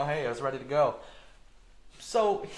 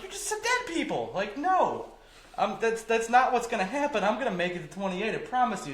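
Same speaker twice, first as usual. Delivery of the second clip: incredulous retort; 7 semitones higher than usual. Retold or imitated. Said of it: "I'm like".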